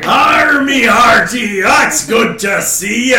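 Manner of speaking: rowdy pirate hailing the party. Acted. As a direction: bellow it like a cartoon pirate. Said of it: "Or pirates".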